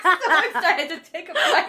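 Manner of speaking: high, giggly squeal; cute excited voice. Pretend. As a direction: squeal it like a kid before a treat. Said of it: "Can you do that one more time?".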